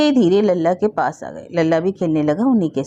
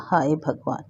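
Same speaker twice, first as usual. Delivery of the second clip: a softer, quivering cry to God; trembling lament; 3 semitones lower, 4 dB quieter than usual. A narrator's reading. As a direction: breathe it out with trembling lips. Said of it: "कांपते होठों से कहा". (कांपते होठों से धीमी आह)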